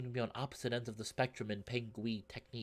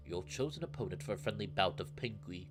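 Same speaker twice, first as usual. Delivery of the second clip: put-on courteous old wolf; 3 semitones lower than usual. Old wolf invites his emperor to a bout, courteous. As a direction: greet an emperor humbly in a lowered voice.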